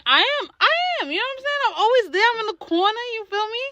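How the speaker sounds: squeaky high voice, fast and playful; high mock boast. Friend hyping herself up in a high mock voice. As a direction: brag in a teasing high pitch. Playful bragging about being loyal.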